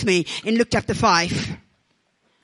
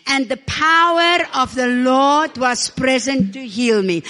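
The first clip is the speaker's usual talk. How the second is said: call and response; 4 dB louder, slower than usual. Shown as a demonstration. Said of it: "Everybody say with me".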